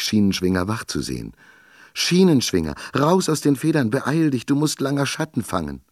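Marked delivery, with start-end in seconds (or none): 1.9-5.8 urgent call. A narrator's reading. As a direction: rouse the sleeping man, urgent and high. Waking the sleeper urgently.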